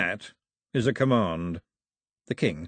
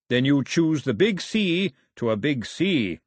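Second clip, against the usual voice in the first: slow, higher, plummy pun delivery; drawn-out plummy punster; 5 semitones higher, slower than usual. A narrator's reading. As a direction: savour a really bad pun plummily. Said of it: "in that slow, plummy voice".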